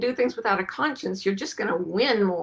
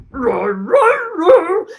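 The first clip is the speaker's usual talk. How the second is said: dog noise; 10 semitones higher, 9 dB louder, much slower than usual. Retold or imitated. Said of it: "And the dog goes".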